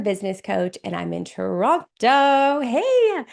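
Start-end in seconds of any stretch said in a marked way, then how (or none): 2.6-3.2 excited greeting. Real, not acted. Spots a fellow Torontonian in chat.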